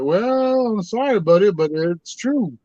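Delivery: high, drawn-out mock-apologetic reply; apologetic retort. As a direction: sing out a reluctant correction to a doubter. Retold reply breaking news to skeptic.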